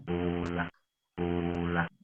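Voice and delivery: ordinary delivery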